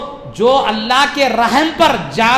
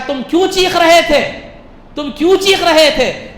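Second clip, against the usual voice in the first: raised, high demanding question; forceful preaching; 8 semitones higher, 6 dB louder than usual. Acted. Allah asks two sinners why they were screaming, forceful preaching. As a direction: press the question hard, voice lifted.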